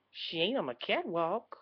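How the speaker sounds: ordinary delivery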